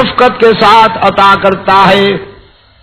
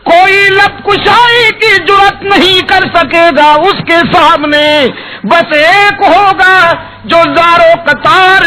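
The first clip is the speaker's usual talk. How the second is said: much higher, pleading preacher's wail; impassioned preaching; 8 semitones higher than usual. Acted. Preacher describing the weeping beloved to the crowd. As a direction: lift the voice high and cry it out. Preaching climax, high pitch.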